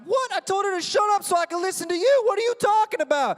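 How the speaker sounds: high, very loud protest; outraged yelling. Retold or imitated. Student to teacher who throws him out, outraged yelling. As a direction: scream back in disbelief.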